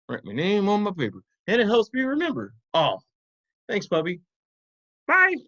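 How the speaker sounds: high puppet voice, eager; squeaky little puppy explaining. Pretend.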